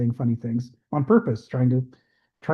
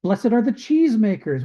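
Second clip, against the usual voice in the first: raised pitch, mock earnest mishearing; mishearing crowd member; 6 semitones higher, 3 dB louder than usual. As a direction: proudly repeat the misheard blessing, a bit louder. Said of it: "And the one guy goes".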